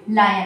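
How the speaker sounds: "लायन" with an eye vowel, falling; correct lion. Shown as a demonstration. Teacher drilling learners, lie-un. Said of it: "क्या बोलेंगे?".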